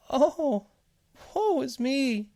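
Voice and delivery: very high tiny whimper; whimpering seed. A narrator's reading. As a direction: wail pitifully as a little seed. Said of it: "the seed whimpered".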